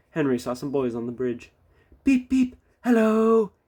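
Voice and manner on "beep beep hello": high, loud whistle-like beeps; cheerful whistle. A narrator's reading. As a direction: toot it happily. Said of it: "he whistled cheerfully".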